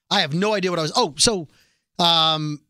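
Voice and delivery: normal talking voice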